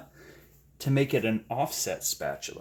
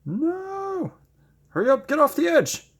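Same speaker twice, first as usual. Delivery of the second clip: alarmed; 3 dB louder than usual. Real, not acted. Paint running off the canvas.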